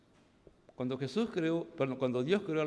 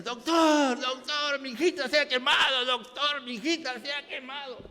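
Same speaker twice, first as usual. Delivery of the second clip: pleading mother's cry, pitched higher; begging the doctor over her burnt daughter; frantic mother; 9 semitones higher, 4 dB louder than usual. Retold or imitated. Mother begs the doctor, higher anxious pleading. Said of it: "Pero ella dice".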